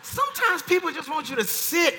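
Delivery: loud, high preacher's cry; preaching at full force. Real, not acted. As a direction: shout it to the congregation.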